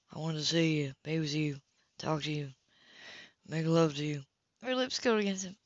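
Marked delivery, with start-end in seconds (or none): 0.1-4.3 soft, low, intimate confession; low, softer lover's murmur; intimate quiet. Acted. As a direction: draw it down soft and close.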